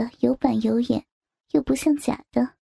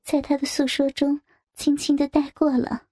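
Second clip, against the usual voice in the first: plain reading voice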